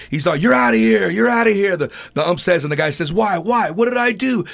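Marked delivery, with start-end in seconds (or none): 0.4-1.8 loud, higher-pitched imitation of an umpire's ejection; mock an umpire throwing someone out; fed-up umpire. Fed-up umpire ejecting an arguing player, mock angry shout. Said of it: "ump was already sick of it". 3.1-4.5 higher-pitched mock protest; arguing player. Player arguing with the umpire, mock indignant protest. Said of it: "he starts arguing with the ump".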